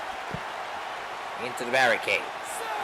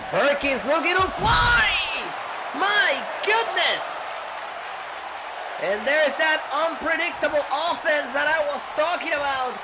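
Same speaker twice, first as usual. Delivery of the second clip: excited shout; 7 semitones higher than usual. Real, not acted. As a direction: sell the big dive. High spot, crowd-popping moment.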